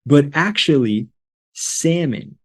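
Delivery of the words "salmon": "salmon" said SAM-un, no L; silent L. Shown as a demonstration. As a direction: leave the L out of "salmon". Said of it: "The L is silent".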